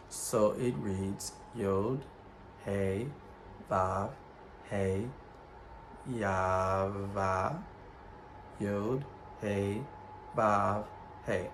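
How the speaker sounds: letter names spelt out one by one, slowly; Yod, Hey, Vav, Hey spelt out deliberately; slow Hebrew spelling. Shown as a demonstration. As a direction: read each Hebrew letter aloud with pauses. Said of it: "show you the Tetragrammaton".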